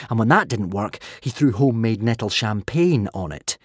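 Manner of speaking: plain reading voice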